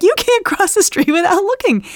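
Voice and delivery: high, loud parental scolding; imitated yelling. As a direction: shout across the street at your child. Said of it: "yelling at her across the street".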